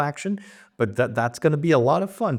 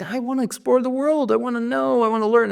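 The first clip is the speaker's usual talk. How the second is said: high, eager pleading; yearning plea; 12 semitones higher than usual. Acted. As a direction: beg to be let go and learn. Daughter pleads with her father.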